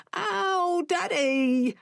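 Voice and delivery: put-on a boy yelling at his father as his arm is pulled; child crying out in pain; child's yell. A narrator's reading. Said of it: "Dom yelled".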